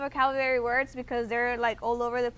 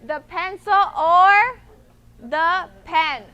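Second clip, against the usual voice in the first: "or" slow and louder, rising and held between the pencil and the pen; emphatic "or"; 3 semitones higher, 8 dB louder, slower than usual. Shown as a demonstration. Teacher explaining an either-or choice, stressing "or". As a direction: stretch "or" to show the choice. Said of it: "So it's either".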